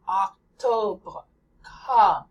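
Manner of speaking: Octobre and comme with an open o like ah; open French O. Shown as a demonstration. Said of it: "ah in opera".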